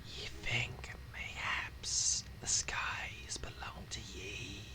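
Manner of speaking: slow, menacing whisper; threatening whispered voice of a sinister fanatic; eerie whispering zealot. Acted. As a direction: hiss out a doom-laden prophecy. GM voices an ominous cultist.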